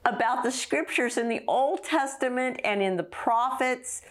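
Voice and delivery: a preacher carried away by delight; awed excitement. Real, not acted. Thrilled by Jesus teaching scripture.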